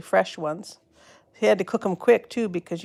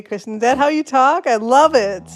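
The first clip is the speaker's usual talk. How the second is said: ordinary talk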